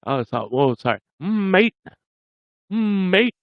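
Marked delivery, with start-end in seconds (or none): 1.2-1.8 long humming "Mm" gliding into a high "mate"; dragged M. Shown as a demonstration. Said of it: "You have to drag that M". 2.7-3.3 long humming "Mm" gliding into a high "mate"; dragged M. Shown as a demonstration. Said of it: "You have to drag that M".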